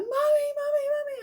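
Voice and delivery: very high, loud frantic cries of Mummy; a scared daughter screaming for her mother; screaming child. Retold or imitated. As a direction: shriek for your mum in panic. Child runs down screaming for mum.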